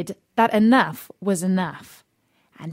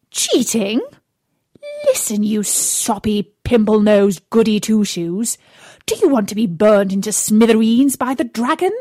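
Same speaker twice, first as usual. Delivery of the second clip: loud, scornful witch scolding; angry stepmother; 6 dB louder than usual. Acted. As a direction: bark insults at the timid prince. Stepmother scolds Freddie angrily.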